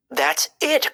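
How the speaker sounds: high, sudden eureka; excited cry. A narrator's reading. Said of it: "cried Timothy".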